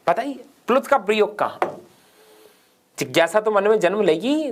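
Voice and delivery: ordinary talk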